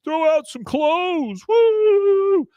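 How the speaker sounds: very high falsetto party crowd, drawn out; mock a whooping crowd in slow falsetto; crowd cheering. Kids outside the closet egging them on, high-pitched cheering.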